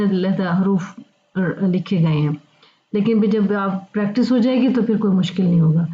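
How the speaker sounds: usual speaking voice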